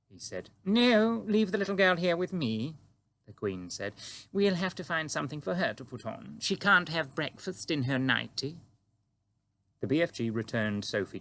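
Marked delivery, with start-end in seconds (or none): 0.6-8.6 Queen greeting the giant calmly; composed Queen. A narrator's reading. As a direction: stay perfectly poised, royal. Queen voiced in story.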